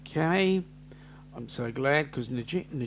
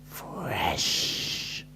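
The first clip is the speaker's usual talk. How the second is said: low whisper of "Fresh"; unvoiced hushed "Fresh"; 11 semitones lower, 4 dB quieter than usual. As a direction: breathe "Fresh" like a cool catchphrase. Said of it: "He's doing it again".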